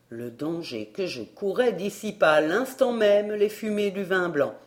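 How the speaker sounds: ordinary delivery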